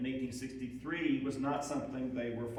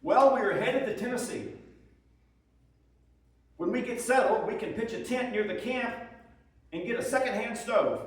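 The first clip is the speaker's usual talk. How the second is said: higher, louder, earnest soldier writing home; soldier's letter; 9 semitones higher, 7 dB louder than usual. Acted. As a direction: read it as a homesick Union private. Reads soldier husband's letters aloud.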